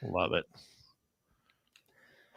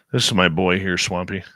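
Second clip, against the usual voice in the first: ordinary delivery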